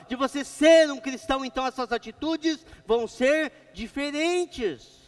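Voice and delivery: loud, fervent preacher voice; preaching. Acted. Pastor preaching loudly to his church, fervent. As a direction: preach it out to the congregation.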